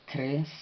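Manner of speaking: Kres as one burst, K and R run together; consonant cluster. Shown as a demonstration. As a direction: raise the pitch on Kres, consonants tight. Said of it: "no llevan vocal".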